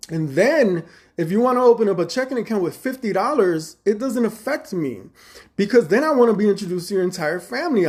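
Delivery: high, loud, fast heated defense. Retold or imitated. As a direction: argue it hot and pressing, voice high and loud. Heated argument with his manager.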